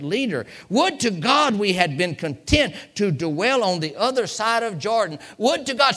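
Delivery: high, loud pulpit lament; impassioned preaching. Acted. Preacher grieving over a leader wanting to go back, impassioned preaching. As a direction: build to a shouted question at the congregation.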